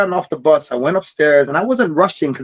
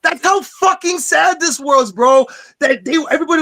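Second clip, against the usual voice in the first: pushed up, almost yelling about the sadness; 11 semitones higher than usual. Real, not acted. Emotional recollection, pitched way up.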